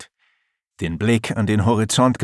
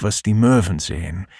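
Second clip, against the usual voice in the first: plain reading voice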